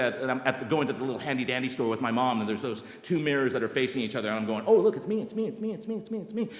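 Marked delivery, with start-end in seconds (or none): 4.7-6.5 high repeated cries fading like endless reflections; childlike delight. Retold or imitated. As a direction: chant it in giddy wonder, trailing away.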